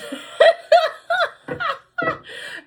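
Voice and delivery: a high squealing laugh breaking out; high burst of laughter. Real, not acted. Reader cracking up at her own skit, high squealing laugh. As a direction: laugh out loud at your own joke.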